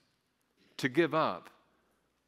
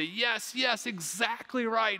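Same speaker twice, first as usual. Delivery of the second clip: excited approval; 9 semitones higher, slower than usual. Pretend. Crowd applauding Mary's gift, imagined.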